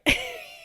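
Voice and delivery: high-pitched burst of laughter. Real, not acted. Reader amused that he said hun, high laugh. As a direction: laugh out at your own joke.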